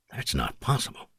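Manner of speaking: very soft, disbelieving whisper. A narrator's reading. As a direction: whisper it, barely believing. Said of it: "Jake whispered".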